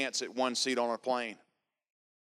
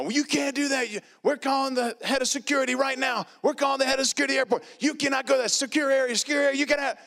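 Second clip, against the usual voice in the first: much higher, louder frantic yelling; shrill mock yell of airport guards; panicked security guards, pitched way up; screaming guards; 13 semitones higher, 4 dB louder than usual. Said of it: "They're screaming".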